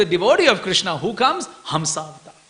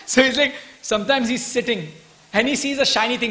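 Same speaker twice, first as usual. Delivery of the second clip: normal talking voice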